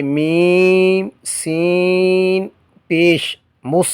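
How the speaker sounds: slow chanted میم سین پیش with the vowel sign last, then مس; correct spelling, drawn out. Shown as a demonstration. Teacher models the correct spelling slowly for students. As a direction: stretch میم and سین before saying پیش. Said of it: "جیسے".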